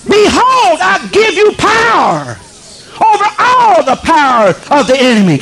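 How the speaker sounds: high shouted scripture, slowed on power; preaching at full force. Acted. Preacher crying scripture to the congregation, full shout. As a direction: thunder the promise out.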